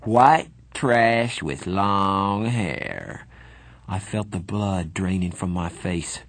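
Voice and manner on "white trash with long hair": very slow, dragged-out sneer; a drunk bully's slow insult; contemptuous. A narrator's reading. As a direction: lay each word down with scorn. Soc insulting greasers.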